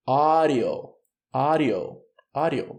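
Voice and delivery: "audio" said slowly with a flapped d, like "ah-rio"; model pronunciation. Shown as a demonstration. Teacher modelling "audio" for students to repeat. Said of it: "vamos ler então".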